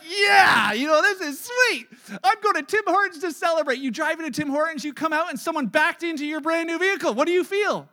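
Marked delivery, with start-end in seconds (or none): 0.0-3.8 loud, high, gleeful bragging; thrilled new-car owner. Acted. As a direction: show off like you just drove off the lot. You feel like this guy.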